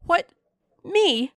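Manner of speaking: high, loud burst of disbelief; astonished exclamation. A narrator's reading. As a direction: blurt it out in amazement. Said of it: "I ejaculated".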